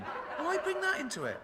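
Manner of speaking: high, slow, laughing complaint; mock outrage. Real, not acted. Comic complains to the crowd about the papers, high and slow. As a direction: squeak out the protest, laughing.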